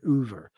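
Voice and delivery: "Uver" with an oo for the first vowel; "Uver", rounded oo in place of oh; Philly pronunciation of over. Shown as a demonstration. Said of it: "Philly people say Uver instead of over".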